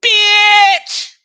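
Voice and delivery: sudden shrieked high yell; a loud, very high shriek of an insult; outburst. Real, not acted. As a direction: blast it out in disgust. Outburst after mocking her.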